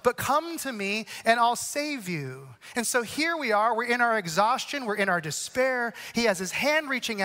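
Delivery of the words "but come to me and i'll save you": "come to me and I'll save you" said slowly, every word weighed; slow emphatic invitation. Acted. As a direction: let each word of the promise land. Repeated invitation, slowed down.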